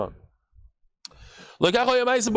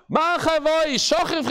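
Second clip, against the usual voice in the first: retold screaming; 8 semitones higher, slower than usual. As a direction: shout it so everyone hears through the windows. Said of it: "he's screaming outside".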